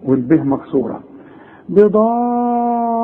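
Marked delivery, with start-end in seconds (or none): 1.7-3.0 بضارين with the "daa" held very long; slow chanted بضارين, sustained before the r; long obligatory madd. Shown as a demonstration. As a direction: stretch the middle vowel on one raised note. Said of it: "المد اللازم".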